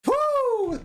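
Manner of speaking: a high, loud cheer of delight; excited whoop. Real, not acted. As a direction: whoop at the finale. Cheering at the finale.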